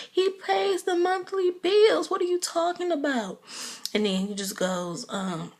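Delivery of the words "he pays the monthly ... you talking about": mimicking Candy. Vlogger imitates Candy defending Todd to her mother, high-pitched. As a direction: defend him in a put-on high voice. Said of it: "You just like".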